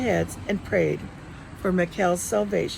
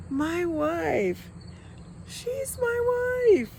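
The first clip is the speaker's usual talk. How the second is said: much higher, drawn-out and slow on the second line; a husband marveling at his bride; awed tenderness; 10 semitones higher, much slower than usual. Acted. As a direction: savor the words in wonder. Husband gazing at his wife.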